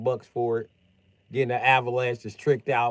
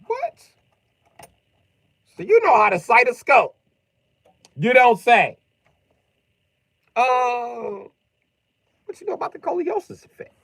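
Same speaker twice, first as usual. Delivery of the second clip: very high, loud mock amazement; mock amazed; 13 semitones higher, 11 dB louder than usual. Quizzing the homeless sniper with mock amazement, very high. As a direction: act dazzled by him.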